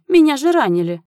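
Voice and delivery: high, loud wounded protest; indignant exclamation. A narrator's reading. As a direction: cry out in aggrieved disbelief. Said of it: "воскликнул он".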